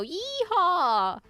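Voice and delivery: high hammed-up Western yeehaw; bad Western accent. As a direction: attempt a cowboy's Western accent and fail. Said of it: "I can't do a Western accent".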